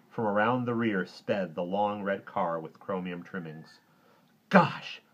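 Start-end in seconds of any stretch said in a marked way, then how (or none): 4.5-5.0 put-on loud, high boyish outburst; excited kid's cry; amazed exclamation. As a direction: burst out in wonder. Boy recognizes the speeding car.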